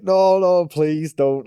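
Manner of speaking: high, loud mock begging; retold pleading. Skater begging friend not to enter shop, mock pleading. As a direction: beg off in exaggerated protest.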